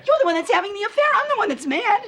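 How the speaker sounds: high-pitched, heated accusation; outraged wife in a quarrel; indignant anger. Acted. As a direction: insist on it, voice climbing high. Marital quarrel over supposed affair.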